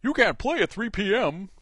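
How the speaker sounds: a hint of a goofy park mascot; lightly voiced cartoon moose announcer; Wally World Moose voice. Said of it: "I should do it in the Wally World Moose's voice".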